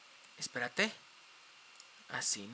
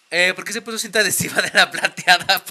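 loud laughing question; real amused laughing; 9 dB louder than usual. Real, not acted. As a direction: laugh through the words. Laughing at silver mask.

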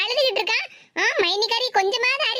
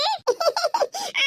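put-on baby giggle; 8 semitones higher, 4 dB quieter than usual. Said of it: "எப்படி சிரிப்பு சிரிக்கா?".

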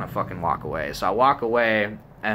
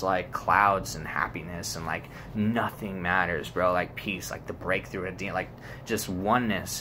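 hushed and slowed down; peaceful reverie; 4 semitones lower, 6 dB quieter than usual. Real, not acted. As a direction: drift dreamily through the thought. Describes imagined death as peace.

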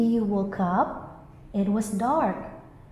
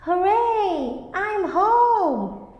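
much higher, louder cheer; joyful cry; 9 semitones higher, 6 dB louder, slower than usual. A narrator's reading. Bee arrives home, loud joyful cheer. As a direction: shout with joy at getting home. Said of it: "cried the greedy bee".

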